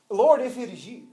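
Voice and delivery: lightly doubtful, voice lifting at the end; mildly mock-skeptical Peter, checking if it's true; questioning. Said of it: "here he is questioning the Lord".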